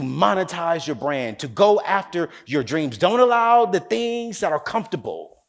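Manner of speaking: full-force sermon push, driving the point home; preaching. Acted. Motivational speaker exhorts the audience at the climax, preaching.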